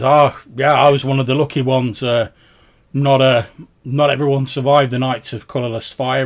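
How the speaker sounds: loud voice of a ferryman survivor; hearty ferryman. Acted. Ferryman tells travellers of surviving the fire, in a loud voice. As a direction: speak up as a lucky old ferryman. Game master plays the ferryman.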